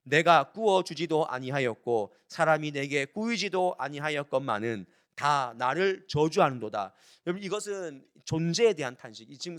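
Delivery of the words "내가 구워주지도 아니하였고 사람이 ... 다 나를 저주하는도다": loud, measured Bible reading; proclaimed verse with drawn-out phrasing; scripture reading. Acted. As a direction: read the verse out to a congregation, firm and deliberate. Reads scripture aloud to congregation.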